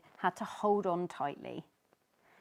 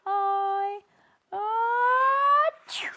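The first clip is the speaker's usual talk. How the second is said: big high loud achoo; loud sneeze; 13 dB louder, much slower than usual. Acted. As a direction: sneeze it out hard.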